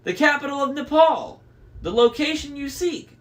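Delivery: high-pitched crone showing off; boastful hag. A narrator's reading. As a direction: pipe up high, eager to outdo your sisters. Sisters boasting of what they know.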